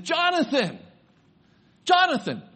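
loud, high-pitched calling out; grandpa's excited shout; yell. Retold or imitated. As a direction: holler the name out to a running child. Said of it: "And so I yell".

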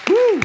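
loud, high "woo"; cheering whoop. Real, not acted. Congregation greeting the new Marine, loud high cheer. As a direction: whoop in celebration.

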